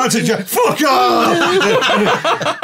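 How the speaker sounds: loud, high, laughing taunt; laughing shout. Retold or imitated. Taunting the German on the floor, loud high laughing shout. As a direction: shout it at him, cackling. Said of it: "I'm like".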